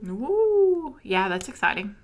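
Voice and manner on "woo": high, loud woo; celebratory whoop. Real, not acted. Celebrating co-host getting vaccinated, high whoop. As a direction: whoop it up for a friend. Cheering friend being vaccinated.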